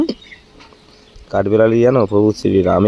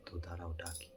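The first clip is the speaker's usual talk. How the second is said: secret whisper, much softer; 2 semitones lower, 23 dB quieter than usual. Real, not acted. Husband secretly whispers to viewers about the hidden gift. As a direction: hush it so the wife won't hear.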